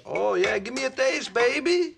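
put-on high slick accent, drawn out; Rico accent. Husband in Rico accent asking wife for a taste. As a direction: tease her as Rico.

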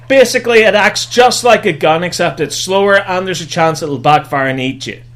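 normal talking voice

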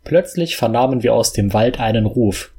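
plain reading voice

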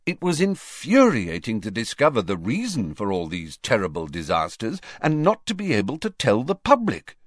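ordinary delivery, nothing marked

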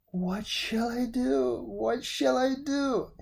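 high, slow, tearful wail; sobbing despair. A narrator's reading. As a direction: break down in tears at the news. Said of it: "he sobbed".

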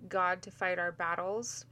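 usual speaking voice

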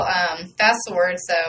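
ordinary delivery

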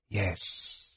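very soft, faint reply with long pause; hushed, breath-thin answer. A narrator's reading. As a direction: barely let the words out. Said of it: "faintly, he replied".

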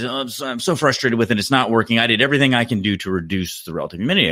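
frustrated customer. Retold or imitated. British customer insists to installer he tried everything, mock frustrated, no accent. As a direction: imitate a caller protesting at growing pace. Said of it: "He's actually British".

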